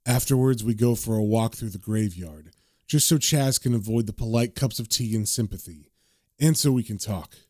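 low, lightly voiced inner monologue; understated, downbeat narrator; John's subdued narration.

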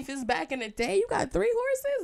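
high, loud, fast whoa; cheering someone on the up; mock hype. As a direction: hype up the three horses.

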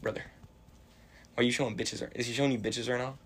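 usual speaking voice

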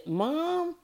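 put-on high child's voice calling Mom; little son calling mom. Son at four telling his mom, high child voice. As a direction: call out like a four-year-old. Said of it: "my son at four years old came to me and said".

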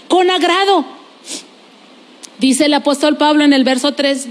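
ordinary delivery

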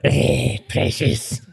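a hint of hissing Gollum; Gollum impression. Mocking a mate hunched in secret, a light Gollum voice. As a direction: croak it like a creature hunched over. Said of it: "You look like fucking Gollum".

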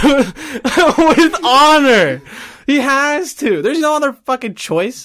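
high, loud, drawn-out honor; mock honor rant.

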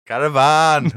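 a laughing, high Welsh "Caravan"; Welsh accent. Shown as a demonstration. Host's last try at a Welsh accent. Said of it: "I've got this".

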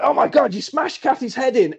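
high-pitched acted horror; hammed-up appalled exclamation; mock shock. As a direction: play a shocked bystander. Acts Cheryl's shocked reaction.

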